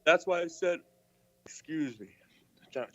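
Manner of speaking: talking through a yawn; words stretched by a yawn. Real, not acted. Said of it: "but I was yawning".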